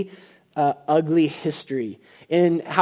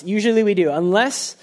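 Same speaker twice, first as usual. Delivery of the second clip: ordinary delivery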